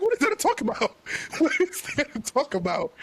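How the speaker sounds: high, laughing disbelief; high-pitched mock outrage; incredulous high quote. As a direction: squeal the question in disbelief.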